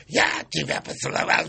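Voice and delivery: exaggerated mock of a diva line. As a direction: throw it out as an overdone parody. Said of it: "что ты пародируешь".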